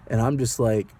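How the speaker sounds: ordinary talk, nothing marked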